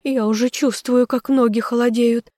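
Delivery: high, melodramatic duchess wail; lamenting. A narrator's reading. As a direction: bemoan your own dying. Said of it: "причитала она".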